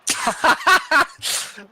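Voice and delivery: high, loud burst of laughter; hearty laugh. Real, not acted. Cracks up at chat joke.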